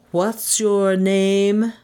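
very slow, drawn-out demand; pompous caller. A narrator's reading. President demanding her name, slow imperious voice. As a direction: stretch every word with authority.

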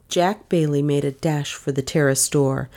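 usual speaking voice

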